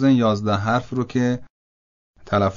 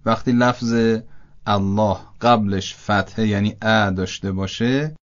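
normal talking voice

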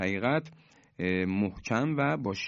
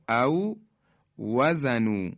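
recitation; 2 semitones higher, 3 dB louder, much slower than usual. Shown as a demonstration. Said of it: "واو اولی ساکنه".

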